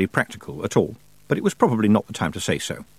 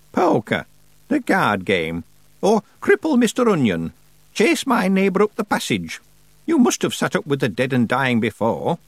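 put-on raised, deliberate voice of an imperious old witch; old witch Miss Treason; 4 semitones higher than usual. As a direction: play the grand old witch holding court.

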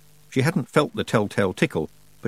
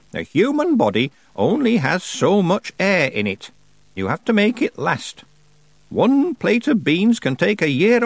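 put-on raised, deliberate voice of an imperious old witch; old witch Miss Treason; 8 semitones higher, 3 dB louder than usual. Old witch advising young Tiffany, imperious and slow. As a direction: play the grand old witch holding court.

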